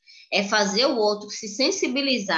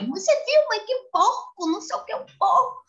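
put-on high, disgusted little girl; a kid appalled at a litterer, pitched way up; outraged child; 11 semitones higher than usual. Daughter tells mother about the litterer, high and disgusted. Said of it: "Ela fica revoltada".

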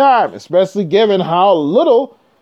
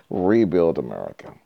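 mock deep echo; 14 semitones lower, 7 dB quieter than usual.

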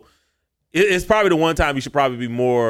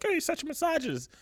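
a squeaky, much higher sneering voice; mock critic voice; 9 dB quieter than usual. Podcast host mocking a critic who clips him, high squeaky sneer. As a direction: lampoon an online critic in a thin high squeak.

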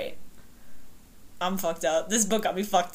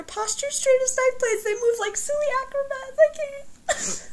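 tearful. Real, not acted. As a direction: voice climbing high into a cry. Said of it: "we're both crying again".

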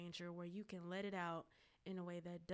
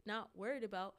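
ordinary delivery

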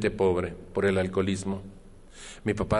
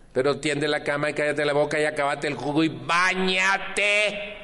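nagging mother; 7 semitones higher than usual. Retold or imitated. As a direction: rattle off orders like an exasperated mother. Mother's morning orders voiced.

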